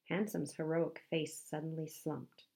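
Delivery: ordinary delivery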